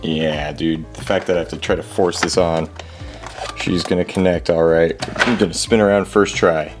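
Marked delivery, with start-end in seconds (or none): none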